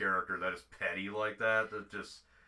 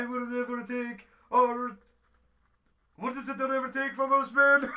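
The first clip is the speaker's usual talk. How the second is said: high, loud, strained mock battle cry; Braveheart impression; 9 dB louder than usual. Podcaster riffing on brain fart misheard as Braveheart, mock rallying shout. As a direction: bellow it like a hammed-up Scottish war speech.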